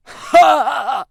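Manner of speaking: loud mocking guffaws; big performed group-style laugh; burst of laughter. A narrator's reading. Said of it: "They just burst out laughing".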